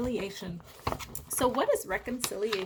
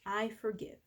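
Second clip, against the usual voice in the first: softer I forgive; soft on the out-breath; 5 dB quieter than usual. Shown as a demonstration. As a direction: breathe it out gently. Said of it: "breathe out and say".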